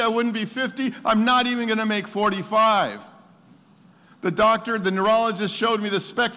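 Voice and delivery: loud, high-pitched preaching; exultant testimony. Real, not acted. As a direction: proclaim it with full excitement. Impassioned testimony of beating prognosis.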